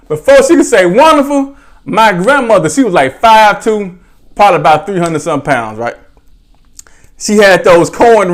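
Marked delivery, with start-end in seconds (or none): none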